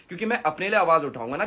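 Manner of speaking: ordinary talk, nothing marked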